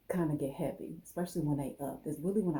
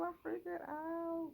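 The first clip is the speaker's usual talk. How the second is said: much higher and slow, stretching the words; sing-song high mock panic, slowed; drawn-out squeak; slower than usual. Cutting her locks, she stretches a high mock panic.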